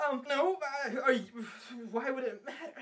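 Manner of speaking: imitating a high, stammering freak-out; flustered stutter. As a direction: stammer it out in a mock panic. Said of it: "he was stuttering the whole time".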